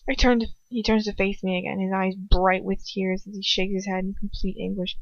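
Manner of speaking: usual speaking voice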